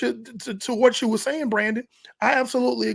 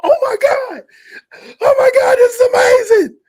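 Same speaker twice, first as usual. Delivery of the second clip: high, loud, squealing with excitement; shrieking with delight, much higher and louder; overexcited kid; 10 dB louder than usual. Retold or imitated. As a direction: scream it like a boy on his first boat trip. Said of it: "like that".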